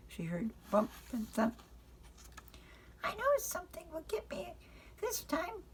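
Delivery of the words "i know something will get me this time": high, hushed, fearful; a child sure the monster is coming; scared little girl. Acted. Frances alone in bed, frightened of the noise.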